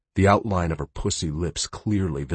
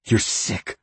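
spat; 3 semitones higher than usual. Acted. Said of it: "she spat".

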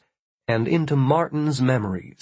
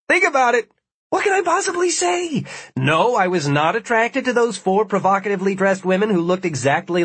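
high, loud frantic protest; flustered exasperation; 9 semitones higher, 3 dB louder than usual. Acted. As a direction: argue like you're cornered. Martin flustered, arguing his case.

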